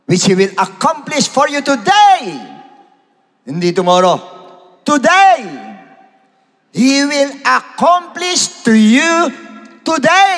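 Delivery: high, loud, slow preacher's shout; full-force preaching. Acted. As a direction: thunder the promise out to the congregation. Preaching Moses' words at full force.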